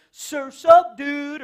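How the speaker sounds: California surfer. Retold or imitated. Californian surfer greeting a buddy, high and loud. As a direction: toss it off like a beach bum. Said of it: "you say California".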